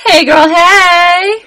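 sassy greeting. Acted. Greeting hype at party.

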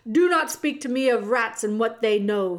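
loud royal command; shouted. Acted. The king shouts down the mouse about rats. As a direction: shout him down. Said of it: "he shouted".

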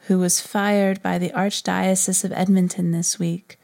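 normal talking voice

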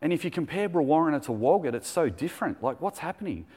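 café lady retelling the change, amazed. Retold or imitated. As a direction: imitate the lady marvelling at the town. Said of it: "She goes".

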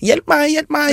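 calling for help. Acted. Injured ant calling its colony after getting up, high mock cry for help. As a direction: play a tiny ant wailing for rescue. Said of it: "så roper den på hjelp".

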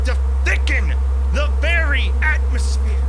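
breathless building alarm in the telling; rising panic. A narrator's reading. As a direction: read it like the dread is catching up with you. Narrator's panic rises as friend vanishes.

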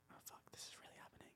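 fast, breathless whisper of disbelief; stunned whisper. Retold or imitated. Recalling the moment he got the job offer, a fast stunned whisper. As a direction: whisper it like you can't believe it's real.

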